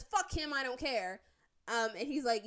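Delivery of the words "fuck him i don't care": higher and louder, tossed-off callous jab; loud, dismissive imitation of him; mocking callous retort. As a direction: bark it out like you couldn't care less. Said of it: "he then says".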